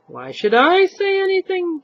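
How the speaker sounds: a touch of wicked triumph; triumphant. Acted. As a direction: gloat to the air. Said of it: "slow, wicked, triumphant smile".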